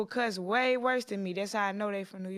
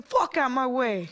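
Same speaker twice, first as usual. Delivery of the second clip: louder, higher mock New York accent; 4 semitones higher, 7 dB louder than usual. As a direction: call it out like a Times Square New Yorker. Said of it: "that's a New York Times Square".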